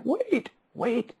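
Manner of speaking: high, hushed retold protest; gently halting. Dad interrupting his daughter under the covers, softly halting her. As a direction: lift it high and soft to stop the child.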